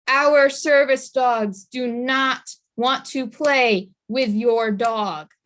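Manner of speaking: slow, every word weighted; speaking to dog owners, slow firm emphasis. Shown as a demonstration. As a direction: spell it out slowly so it sticks. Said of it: "I'm gonna say it maybe more than once".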